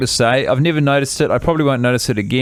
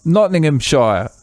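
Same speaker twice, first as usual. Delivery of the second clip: put-on [name]'s English accent. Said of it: "What part of England are you from".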